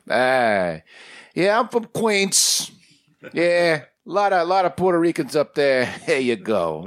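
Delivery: put-on high, slow old Queens accent. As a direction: play a chatty old Queens creator. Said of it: "they all have that same sort of".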